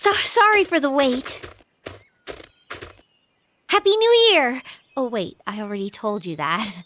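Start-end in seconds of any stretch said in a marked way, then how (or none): 0.1-4.6 cheerful greeting. Real, not acted. Greeting her date at New Year, cheerful and bright. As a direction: burst out with the greeting.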